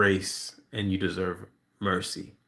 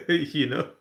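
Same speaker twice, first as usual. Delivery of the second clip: laughing; 6 semitones higher than usual. Real, not acted. Laughing after blunt punchline.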